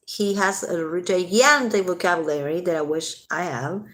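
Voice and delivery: usual speaking voice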